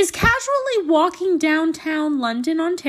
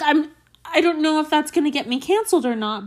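ordinary talk, nothing marked

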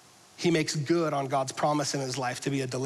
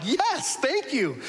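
relieved yes; 8 semitones higher, slower than usual. Retold or imitated. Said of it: "I'm like".

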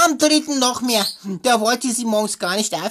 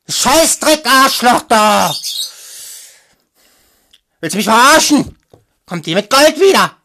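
furious, much louder rooster rant; aggressive rooster; 9 dB louder than usual. Acted. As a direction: bellow it like an enraged rooster. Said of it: "aggressiver Hahn".

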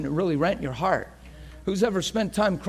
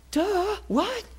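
high, goofy dumbfounded voice; dopey realization; 11 semitones higher than usual. Acted. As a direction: play it comically slow to catch on. Acting out dawning realization.

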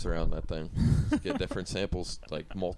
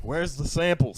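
louder, higher yelled demand; mock yelling; 8 semitones higher, 6 dB louder, slower than usual. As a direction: yell it over a crowd. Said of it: "Just yelling over people".

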